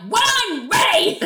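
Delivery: high, drawn-out mock shout; imitated angry holler; really angry. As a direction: yell it as a hammed-up angry retort. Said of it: "like, really angry".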